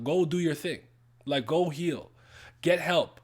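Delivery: ordinary talk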